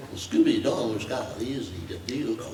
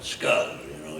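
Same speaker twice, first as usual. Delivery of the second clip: ordinary delivery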